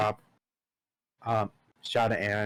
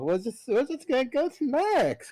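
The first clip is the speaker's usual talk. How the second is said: mock-cheery high resignation.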